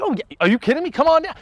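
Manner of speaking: a hint of a pushy salesman, pitched high; mock-eager dealer welcoming you in; lightly voiced car salesman. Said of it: "the guy's like".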